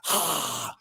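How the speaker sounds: soft, low, fading cobra hiss; quiet imitated hiss. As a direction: let out a quiet snake hiss. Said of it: "and cobras go".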